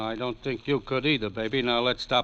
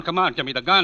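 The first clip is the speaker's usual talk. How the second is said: louder, higher standoff; tense raised voice; 6 semitones higher, 5 dB louder, faster than usual. Acted. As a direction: push back hard at gunpoint.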